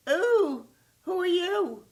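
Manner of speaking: high, loud, startled "Ooh, who are you?"; surprised squeal. Acted. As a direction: shriek it out in wide-eyed wonder. Jimmy meets the lie creature.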